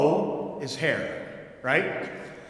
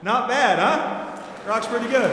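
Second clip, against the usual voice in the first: higher and louder, delighted with the joke; proud grin after the punchline; tickled pride in the bit; pleased and excited; 7 semitones higher, 4 dB louder than usual. Real, not acted. Pleased after finishing the joke story.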